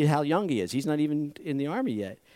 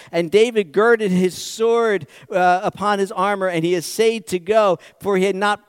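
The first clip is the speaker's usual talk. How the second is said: scripture reading; 4 semitones higher, 6 dB louder than usual. Acted. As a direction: read the verse with weight. Reads Samuel aloud.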